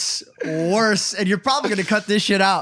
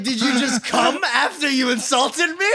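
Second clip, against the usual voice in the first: high, slow words through laughter; laughing disbelief; 6 semitones higher, slower than usual. Real, not acted. Telling friends the grandpa story, laughing through it.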